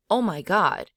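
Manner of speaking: "Oh my God" exclaimed with falling peaks; high falling exclamation. Shown as a demonstration. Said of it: "high falling tone".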